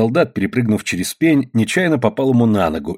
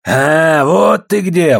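loud, raised-pitch gotcha; menacing recognition; 5 semitones higher, 5 dB louder, much slower than usual. A narrator's reading. Man in uniform spotting Андрюшка, loud and high. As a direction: pounce on him with a loud high call. Enemy spots Андрюшка in brawl.

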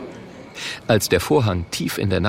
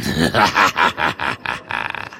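low staccato villain cackle; greedy gloating laugh; 6 semitones lower than usual. Acted. The Murk relishing more entrance money, gloating laugh. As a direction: laugh low in a long dying run.

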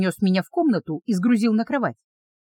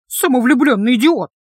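louder, scornful insult; angry insult; 3 semitones higher, 5 dB louder than usual. A narrator's reading. Heroine insulting the dragon guard, angry. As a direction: spit out the insult.

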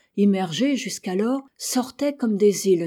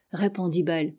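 ordinary delivery

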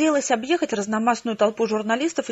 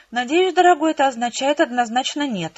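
ordinary delivery, nothing marked

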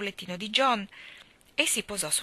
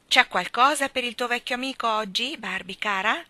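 louder, higher, distinct coaxing question; clearer repeat; 5 semitones higher, 7 dB louder than usual. A narrator's reading. Starling asking Barbara a second time, more clearly. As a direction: spell out the request for the baby. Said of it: "ripeté più chiaramente".

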